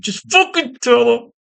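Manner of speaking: high, slow, strained begging; exasperated plea. Acted. Comic reader fed up with the secret identity, exasperated plea. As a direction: stretch it out in high-pitched frustration.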